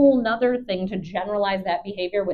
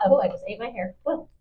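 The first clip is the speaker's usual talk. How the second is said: ordinary delivery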